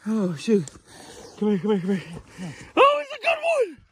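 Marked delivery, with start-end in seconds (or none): 2.8-3.8 high, thrilled exclamation; stunned delight at the catch; amazed. Real, not acted. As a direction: react to seeing the size of the fish. First sight of the fish.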